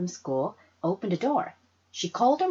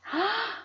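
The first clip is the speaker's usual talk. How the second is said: gasp. A narrator's reading. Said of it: "I gasped".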